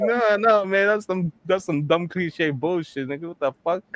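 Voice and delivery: high-pitched, incredulous refusal; mock protest. Biker rejects his friend's idea, high and dismissive.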